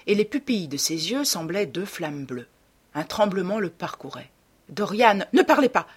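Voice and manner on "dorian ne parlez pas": loud, high command; agitated exclamation; urgent outburst. A narrator's reading. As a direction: cut the other off sharply. Painter interrupted in acted quarrel.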